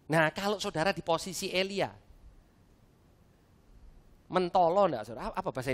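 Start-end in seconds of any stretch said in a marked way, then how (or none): none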